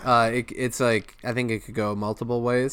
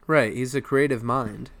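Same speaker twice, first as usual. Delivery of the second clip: ordinary talk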